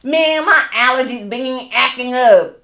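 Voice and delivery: slow, drawn-out southern accent mocking husband. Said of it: "with the southern accent".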